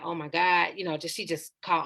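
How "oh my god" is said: ordinary delivery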